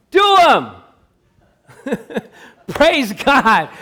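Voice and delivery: loud, high, fiery pulpit voice; preaching at full force. Acted.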